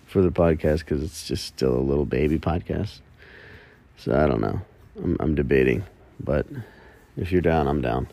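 ordinary talk

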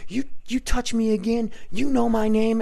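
high, heated retelling of a confrontation; angry threat. Retold or imitated. Man cursing at the clown who poked him, angry. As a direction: act out an outraged warning.

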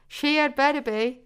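smug, lifted voice; smirking. A narrator's reading. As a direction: say it through a smirk, pointedly. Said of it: "smirked Zoya".

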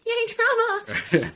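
high mock-cheerful squeal; sarcastic cheer. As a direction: fake celebration with laugh. Sarcastic cheer about therapy.

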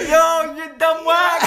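slow, high, loud mock yell; mock shout. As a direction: yell it, laughing at him.

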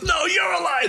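high, shouted comeback; heated mock argument; retold shouting. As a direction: throw it straight back, yelling. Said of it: "They were screaming".

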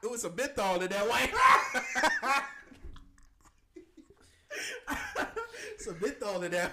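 high-pitched words through real laughter; helpless, high laughing delivery; cracking up. Real, not acted. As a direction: barely get the joke out for laughing. Cracking up at the joke.

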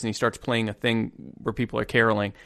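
ordinary talk

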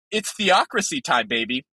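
usual speaking voice

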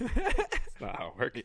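slow, laughing through the words. Real, not acted. Co-host cracking up at the bit, laughing. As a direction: giggle the line out.

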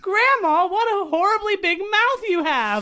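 high, loud panicked cry; frightened shout. Retold or imitated. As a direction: cry out in rising fear. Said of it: "the iconic lines".

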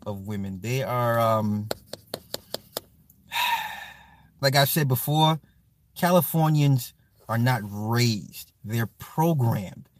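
normal talking voice

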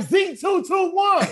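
high, slow shout; shouting across the parking lot; yelled name. Retold or imitated. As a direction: bellow the handle out loud. Yelling a Twitter name.

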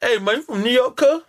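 high-pitched nosy stranger; imitated out-of-towner. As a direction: call it out high, like a curious stranger. Acting out out-of-towners spotting accent.

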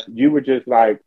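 ordinary delivery

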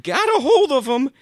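worked-up, loud insistence; exasperated yelling. Acted. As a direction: explain it loudly, out of patience. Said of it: "I'm sorry I yelled at you".

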